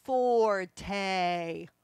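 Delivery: booming "forte"; loud forte. Shown as a demonstration. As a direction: announce the word big. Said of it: "that word is".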